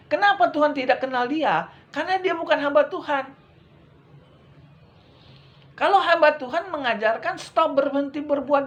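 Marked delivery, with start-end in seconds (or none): none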